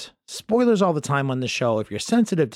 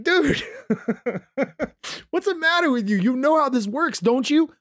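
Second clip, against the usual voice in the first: much higher, mock-incredulous squeal; mocking disbelief; 12 semitones higher than usual. Host mocking upset fans in a higher, mocking voice. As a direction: tease them like they're clueless. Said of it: "And I'm just like".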